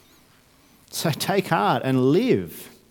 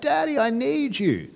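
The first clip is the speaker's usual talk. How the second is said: put-on much higher, slow child's sing-song; sing-song kid call; 12 semitones higher than usual. Kids calling their dad at night, high sing-song. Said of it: "in that really sing-song voice".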